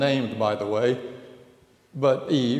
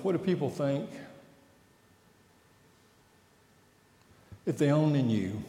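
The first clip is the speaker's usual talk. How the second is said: doubting inner voice; 2 semitones lower, 8 dB quieter, faster than usual. Shown as a demonstration. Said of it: "You know that voice?".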